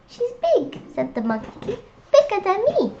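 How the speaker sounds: high little monkey voice. Acted. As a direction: answer like a small worried monkey. Monkey answers.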